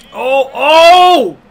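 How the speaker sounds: high, loud oh oh; excited shout. Real, not acted. Watching ground and pound, shouting in excitement. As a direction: cry out at the elbow. Big elbow in the fight.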